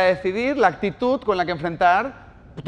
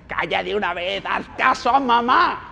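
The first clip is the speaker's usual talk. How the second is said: channel the mother from Psycho; creepy Psycho mother; 4 semitones higher than usual. Retold or imitated. A mother snapping at her crying child in a sinister voice. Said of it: "me sale una voz y le digo".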